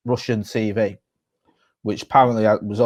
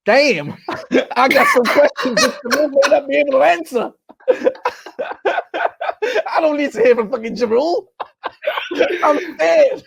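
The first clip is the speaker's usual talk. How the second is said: much higher put-on voice, laughing all through; 14 semitones higher than usual. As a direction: squeak out a panicked rapper while cracking up. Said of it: "[name] impression of them doing this".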